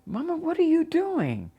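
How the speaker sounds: very high-pitched protest; imitating a high, upset cry to mother; alarmed protest. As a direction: plead upward in startled disbelief. Retold protest to mother striking her.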